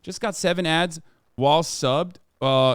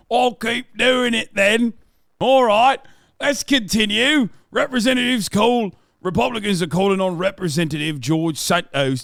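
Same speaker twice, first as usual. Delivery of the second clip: put-on loud, higher British accent for the chat; hammed-up British accent; 5 semitones higher, 5 dB louder than usual. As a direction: play the posh Brit to amuse viewers. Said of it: "keep doing the fucking British".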